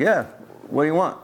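mock Greek-accented older man; thick Greek accent. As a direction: challenge visitors with a hint of a Greek accent. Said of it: "he had a thick accent".

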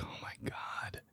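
breathless whisper. Retold or imitated. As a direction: mutter it under your breath. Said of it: "I was like".

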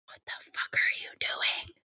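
hushed, unvoiced whisper to the kids; retold horrified whisper; mortified whisper. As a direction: whisper it, embarrassed in public. Embarrassed aunt in grocery store.

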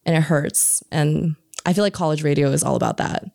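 ordinary delivery

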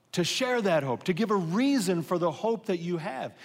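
preacher driving hope home to the congregation; full-force preaching. Real, not acted. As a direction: build the sermon to a ringing climax.

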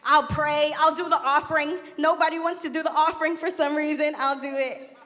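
frantic bargaining. Retold or imitated. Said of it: "I began to even bargain".

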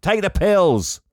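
high, loud mock shout; acted angry order. Doctor ordering Jerry to take medication, sudden mock shout. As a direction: burst out as the fed-up doctor, loudly. Doctor snapping at patient in retelling.

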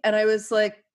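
ordinary talk, nothing marked

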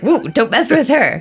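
high, loud mock warning. Travellers warning each other about a grumpy attendant, mock warning. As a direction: playfully warn everyone off.

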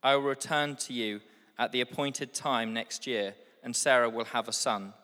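ordinary talk, nothing marked

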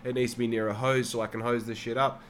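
ordinary delivery

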